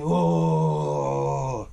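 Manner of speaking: long, low groaning scream; imitated scream. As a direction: imitate a drawn-out, low-pitched howl of pain. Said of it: "like, screaming".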